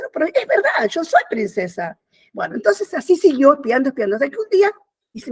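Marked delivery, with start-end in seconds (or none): 0.0-2.0 put-on higher-pitched, earnest child's protest; insistent protest. Girl insisting to her disbelieving sister, insistent protest. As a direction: voice the girl insisting, starting high and dropping.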